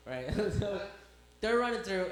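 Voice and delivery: normal talking voice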